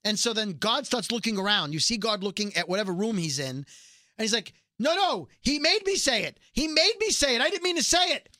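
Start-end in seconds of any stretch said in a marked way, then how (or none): 4.8-8.3 high-pitched frantic excuse; acting out a desperate denial; mock panicked protest. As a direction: plead innocence in a raised voice. Fake God pleading his innocence, retold.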